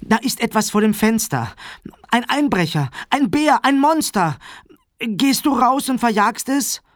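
high, excited alarm, rising as it builds; scared children pleading with Dad; frightened children. A narrator's reading. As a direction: blurt out each danger in a panicky kid voice.

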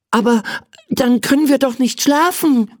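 put-on high, excited child voice; aufgeregter Junge. A narrator's reading. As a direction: call out in a boy's upset protest. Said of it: "rief er aufgeregt". (aufgeregte, hohe Kinderstimme)

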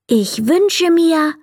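high, drawn-out proclamation; calling out. A narrator's reading. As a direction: announce the wish slowly in a high boy's voice. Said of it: "rief er laut".

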